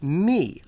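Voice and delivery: me punched loud; me said loud and firm; emphatic me. Real, not acted. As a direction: hit me hard. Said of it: "this is the word me".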